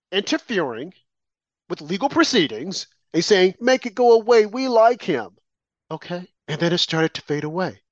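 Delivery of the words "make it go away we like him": louder, higher imitation of an order; mock bossy order. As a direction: insist on it like someone pulling strings. Repeats Michelle's acted order.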